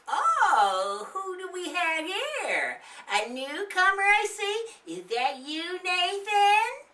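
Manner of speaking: put-on high, wavering old woman voice; a sweet neighbor greeting kids; kind old lady.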